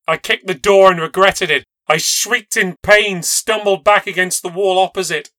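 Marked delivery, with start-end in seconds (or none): none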